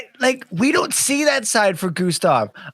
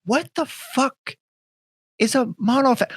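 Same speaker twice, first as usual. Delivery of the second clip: hushed, incredulous high voice; baffled; 8 semitones higher than usual. Retold or imitated. Baffled reply to client's term.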